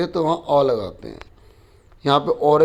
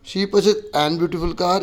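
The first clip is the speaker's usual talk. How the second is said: slow reading; much slower than usual. Shown as a demonstration. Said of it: "teacher tries each option".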